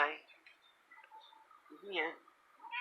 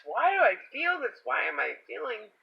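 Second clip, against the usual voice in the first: incredulous; 7 semitones higher, 5 dB louder than usual. Retold or imitated. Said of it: "'cause I had asked".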